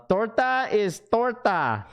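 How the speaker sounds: high, slow, drawn-out "torta is torta"; mock voice. Repeating a viewer's comment to chat in a high, drawn-out mock voice. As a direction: stretch it out high, mocking the line.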